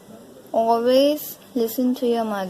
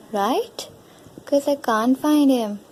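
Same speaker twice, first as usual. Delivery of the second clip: ordinary delivery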